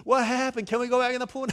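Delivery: put-on high, loud, insistent kid questions; puzzled children. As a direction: imitate kids pestering the grown-ups. Children pester again, acted.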